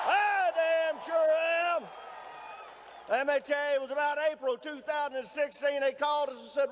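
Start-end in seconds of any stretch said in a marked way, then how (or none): 0.1-1.8 slow, drawn-out shout to the crowd; emphatic rally shout. Real, not acted. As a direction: hammer each word out slowly. Rally opener answering the crowd.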